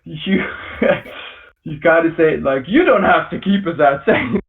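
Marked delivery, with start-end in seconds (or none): 2.7-4.0 commanding, talking down to him; dominating tone. Shown as a demonstration. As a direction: say it like you own the doorstep. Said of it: "with a very dominating tone".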